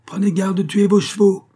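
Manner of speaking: high, loud taunting cry; jeering shout. A narrator's reading. D'Artagnan taunts the steward as he rides off, shouting. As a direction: shout a mocking warning. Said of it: "cria D'Artagnan en éclatant de rire".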